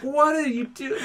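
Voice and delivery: high-pitched retold disbelief; baffled, imitating friends at a loss; incredulous. Friends to the panicking kid in the river, mock incredulous.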